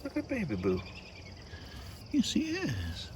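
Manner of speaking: very high, soft cooing pet voice. Real, not acted. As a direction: dote on the cat in a high sing-song. Cooing at the cat.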